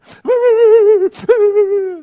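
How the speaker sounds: loud, very high mock wailing; imitated child's wail. Small child in daddy's arms, loudly and shrilly wailing in imitation. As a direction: wail like an upset little child.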